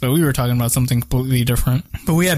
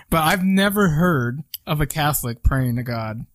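usual speaking voice

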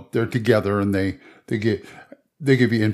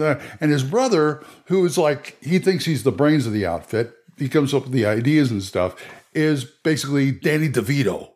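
usual speaking voice

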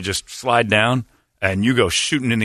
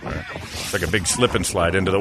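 ordinary talk, nothing marked

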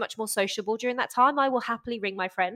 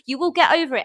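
acted reassurance; 7 semitones higher, 7 dB louder than usual. Voices reassurance after meltdown.

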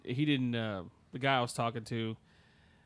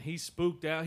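normal talking voice

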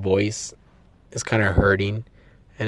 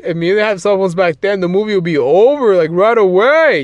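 imitating people; 6 semitones higher, 4 dB louder than usual. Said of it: "people are like".

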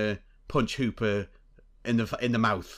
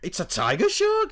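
high, loud incredulous quote rising at the end; mock-offended, as if personally insulted; offended disbelief; 10 semitones higher, 5 dB louder than usual. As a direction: pitch it way up in appalled disbelief. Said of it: "he just looks so offended".

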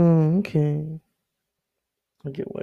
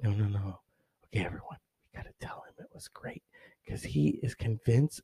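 much softer, whispered conspiracy; hushed aunt; 4 semitones lower, 10 dB quieter than usual. Retold or imitated. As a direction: hush the family like the aunt. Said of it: "my aunt's like".

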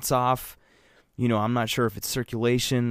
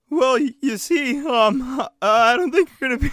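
high, loud, strangled doctor voice; the doctor squeaks it out high and strained; weird strained voice; 8 dB louder than usual. Acted. Punchline, doctor speaking in the same strangled high voice. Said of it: "talk in a very weird way".

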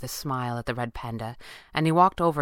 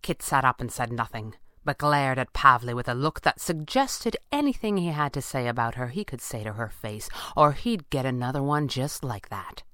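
plain reading voice

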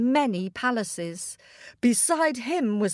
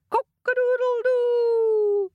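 long, high, loud rooster crow; high sustained cock-a-doodle cry; 12 semitones higher, 4 dB louder, slower than usual. A narrator's reading. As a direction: crow out loud and drawn-out to wake the sleeper. Rooster crows to wake king.

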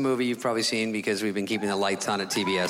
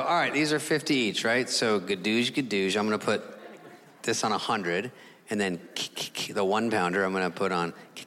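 ordinary delivery

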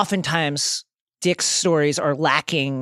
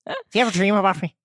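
quick, higher Irish lilt; Irish accent; 4 semitones higher, much faster than usual. Pretend. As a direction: ask it with an Irish brogue. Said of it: "Say Barbara in an Irish accent".

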